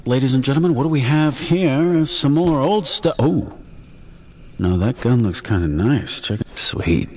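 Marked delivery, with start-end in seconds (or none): 0.1-3.6 high showman barker voice; snake oil seller. Pretend. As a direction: present the junk like a huckster. Resumes barker act on loot.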